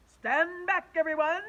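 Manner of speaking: high, loud heroic command; teacher ordering kids back, loud; commanding shout. Acted. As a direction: order them back like a hero.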